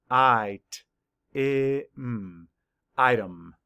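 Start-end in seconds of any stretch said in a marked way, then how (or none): 0.1-2.5 "I, T, I, M" as separate sounds, the second I short; sounded out. Shown as a demonstration. Teacher mapping the sounds of item, sounded out. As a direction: break it into sounds, humming the M. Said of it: "Map the sounds".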